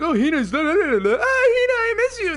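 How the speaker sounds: high, loud whiny gibberish blubber; mock lovesick wailing. Streamer mimicking Natsuo pining for Hina, mock lovesick wailing. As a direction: mockingly wail like a pining lover.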